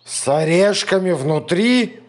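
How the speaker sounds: shudder at the nightmare; horrified monster. A narrator's reading.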